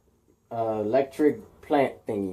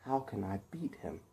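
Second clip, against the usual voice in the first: hushed, much softer; worried whisper; 5 semitones lower, 17 dB quieter than usual. A narrator's reading. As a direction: mutter it to yourself. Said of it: "and he's like".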